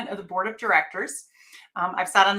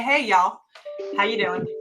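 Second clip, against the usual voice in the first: Southern accent; 4 dB louder than usual. Shown as a demonstration. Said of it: "say where I'm from in a more Southern accent".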